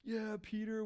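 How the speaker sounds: high, excited cheer; the audience cheers Peter after his song; cheering crowd. Retold or imitated. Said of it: "everybody was like".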